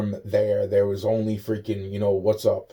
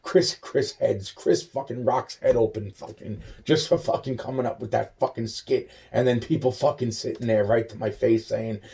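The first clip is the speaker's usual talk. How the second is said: ordinary delivery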